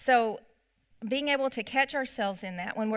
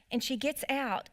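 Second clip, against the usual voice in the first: normal talking voice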